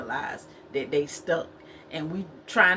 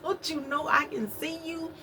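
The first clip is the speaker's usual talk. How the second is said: higher teasing call, rising on you; mock Cat Williams; 8 semitones higher than usual. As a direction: playfully echo the comedian's bit. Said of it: "I'm just like".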